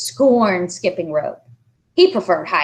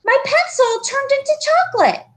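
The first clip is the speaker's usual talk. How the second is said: put-on pleading boy. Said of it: "he begged in a loud whisper".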